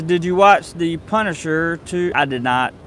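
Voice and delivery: ordinary delivery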